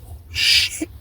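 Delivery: whispered, soft curse; hushed dread. Retold or imitated. Inmate realizes trouble is coming, hushed curse. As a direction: let it out under the breath.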